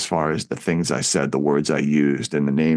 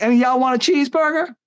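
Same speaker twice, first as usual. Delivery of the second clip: high, fast, put-on mocking voice; high singsong taunt; mocking O-Dog; 3 dB louder, faster than usual. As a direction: toss it out fast in a high cartoonish voice. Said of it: "we'd always laugh and be like".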